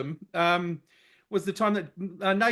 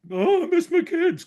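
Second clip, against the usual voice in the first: higher, whiny mock sob; mock whine; 9 semitones higher than usual. Mocking Aussie players who want to go home, whiny. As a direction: whinge like a homesick player.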